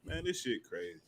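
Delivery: very quiet mock aside; teasing imitation. Podcaster teasing a friend's reaction, hushed imitation. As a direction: say it under your voice, imitating a friend. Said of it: "You be like".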